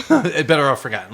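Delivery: repeating the title through a laugh. Real, not acted. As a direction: laugh while echoing the joke.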